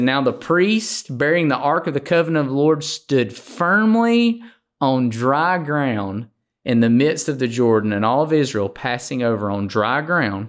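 preacher proclaiming a Bible verse; scripture reading. Acted. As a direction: read the verse out, stretching the weighty words. Reads scripture aloud.

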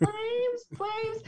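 high, strained seething frustration; seething movie quote. Retold or imitated. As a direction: spit it out like you're about to burst. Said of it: "we might use that phrase in our family to be like".

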